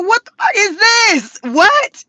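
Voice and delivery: high, loud, disbelieving yell; shocked shout. Retold or imitated. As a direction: shriek at the mirror in horror. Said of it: "I was like".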